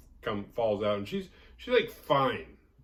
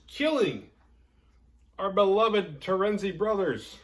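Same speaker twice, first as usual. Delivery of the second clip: mock tragic; 11 semitones higher, 8 dB louder than usual. A narrator's reading. Mock-tragic death of heroes.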